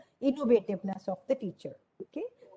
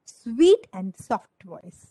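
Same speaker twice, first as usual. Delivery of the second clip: drawn-out answer; 3 semitones lower, 4 dB louder, much slower than usual. Shown as a demonstration. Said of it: "कैसा voice होना चाहिए?".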